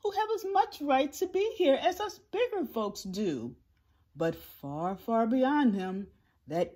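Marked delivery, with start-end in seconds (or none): 0.0-3.6 high, pleading elephant; raised-pitch, anxious begging. A narrator's reading. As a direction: plead earnestly for the little ones. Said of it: "And he begged".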